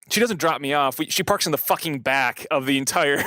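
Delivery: usual speaking voice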